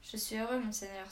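plain reading voice

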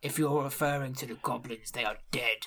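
low, soft, deadpan orc voice; orc chieftain. Acted. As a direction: state it gravely and quietly. Orc chieftain answers the guard.

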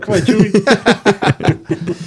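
laughing, slow and drawn out. Real, not acted. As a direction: cheer him on while cracking up. Egging a friend to chug.

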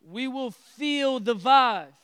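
slow, loud, preached out. Acted. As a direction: belt it to the congregation. Preacher repeats phrase for emphasis.

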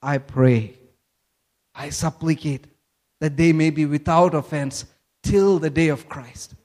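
low, soft supplication; praying. Acted. Preacher voicing Paul praying for offended believers, low and supplicating. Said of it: "that's why he's praying".

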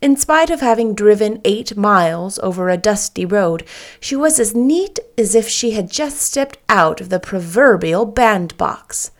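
ordinary delivery

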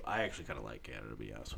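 fast, soft throwaway aside; quick quiet aside. Real, not acted. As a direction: mutter the admission fast.

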